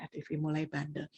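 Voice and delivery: ordinary talk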